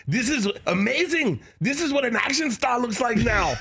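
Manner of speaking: mock Austrian action-star accent; Arnold Schwarzenegger. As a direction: imitate an Austrian bodybuilder marvelling. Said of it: "That's what Arnold kept saying".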